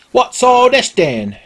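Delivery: high, slow British constable; British bobby. Pretend. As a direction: ask it like a prim London policeman. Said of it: "it's the old-timey British Bobby".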